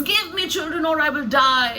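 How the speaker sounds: high-pitched, declamatory demand; dramatic ultimatum. Acted. Rachel demanding children from Jacob, melodramatic ultimatum. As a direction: make it a liberty-or-death declaration, with a tint of sarcastic humor. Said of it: "that kind of a tone".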